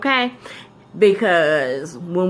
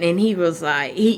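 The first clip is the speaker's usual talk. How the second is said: ordinary talk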